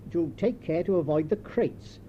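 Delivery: ordinary delivery, nothing marked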